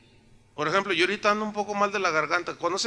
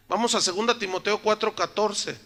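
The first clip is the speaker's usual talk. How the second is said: usual speaking voice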